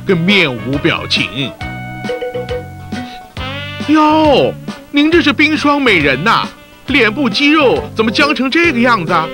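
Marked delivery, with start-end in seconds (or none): 3.9-9.3 high mocking tease; teasing. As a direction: poke fun at her frozen face. Mocking the stiff-faced newcomer.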